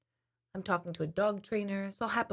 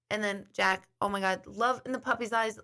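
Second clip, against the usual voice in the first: ordinary delivery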